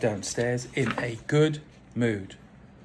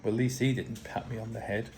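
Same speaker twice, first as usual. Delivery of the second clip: ordinary delivery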